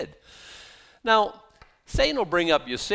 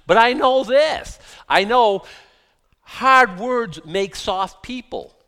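slow, loud, every word weighted; slow emphatic maxim; 6 semitones higher, 9 dB louder, slower than usual. Real, not acted. Preacher driving home his maxim to the church, slow and loud. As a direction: lean hard on each word.

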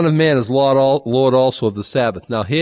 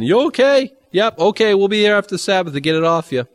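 high, raised mock call; yelling; 9 semitones higher, 4 dB louder than usual. Rescuer to a guy under a fallen house, high pretend yelling. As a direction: call out to someone trapped under rubble. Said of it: "You yelling".

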